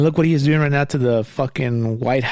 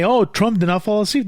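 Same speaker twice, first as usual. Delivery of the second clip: mocking quote; 6 semitones higher than usual. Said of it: "that little pathetic little Cuban named Marco Rubio say".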